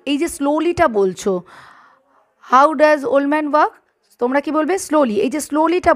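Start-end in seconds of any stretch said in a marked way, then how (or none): 2.5-3.7 deliberately slow question; teacher repeating slowly for students. Shown as a demonstration. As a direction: space the words out for the class. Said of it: "আমি আর একবার repeat করি".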